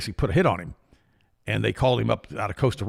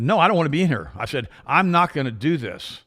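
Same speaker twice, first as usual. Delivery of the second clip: firm refusal; 5 semitones higher, 6 dB louder, slower than usual. Retold or imitated. Retold confrontation in the car.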